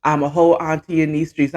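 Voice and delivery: usual speaking voice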